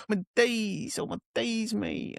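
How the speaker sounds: imitating the lad's slang, dying away; mocking kid slang. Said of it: "You sound like an absolute tool".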